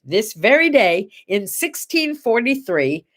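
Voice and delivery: ordinary talk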